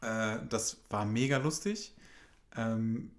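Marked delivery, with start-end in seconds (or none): none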